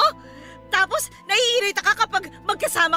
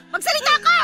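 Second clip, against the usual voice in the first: shouting demand; 7 semitones higher than usual. Acted. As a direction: demand she speak. Mother demands an answer.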